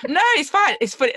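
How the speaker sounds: high, loud, laughing through words. Real, not acted. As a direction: giggle while reassuring. Laughing along after co-host laughs.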